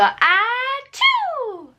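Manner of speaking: high playful fake sneeze "Ah, choo"; squeaky pretend sneeze for baby; mock sneeze. Shown as a demonstration. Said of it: "Can you sneeze? Go".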